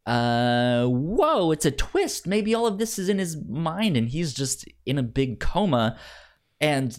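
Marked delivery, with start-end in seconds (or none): none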